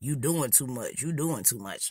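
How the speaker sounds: low, soft, imitated boy's warning; warning classmate. Boy trying to warn the girl off, low hushed warning. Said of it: "he keep trying to warn me".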